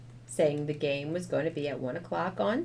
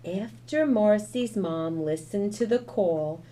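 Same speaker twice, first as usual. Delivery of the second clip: usual speaking voice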